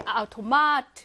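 "아우토마트" with the weight on 마; stress on third syllable. Shown as a demonstration. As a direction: hold back the stress until 마 in "아우토마트". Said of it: "삼 강세에 오거든".

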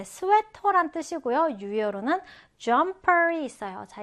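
ordinary talk

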